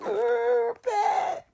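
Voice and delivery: high-pitched whiny sob, imitated; mock crying. Denise balking at moving the dead body, mock crying. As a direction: wail the name in a hammed-up cry. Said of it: "Denise get the crying".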